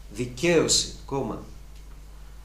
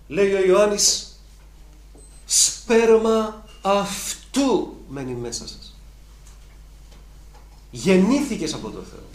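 higher, louder, slow fervent proclamation; full-force preaching; 7 semitones higher, 8 dB louder, slower than usual. Acted. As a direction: preach it to the rafters.

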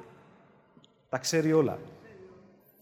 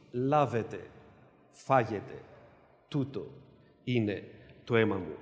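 hushed solemn words; 10 semitones lower, 6 dB quieter than usual. Acted. Preacher voices the Lord's words.

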